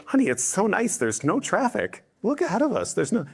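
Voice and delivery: high, easygoing voice of an oblivious driver. Acted. Phlegmatic driver chatting to honey about the road, oblivious. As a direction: coo in blissful, high-pitched ignorance.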